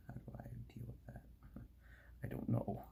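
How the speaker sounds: hushed, quick whisper to himself. Real, not acted. Puzzling over a stuck part, whispering to himself. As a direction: whisper it like a worried secret.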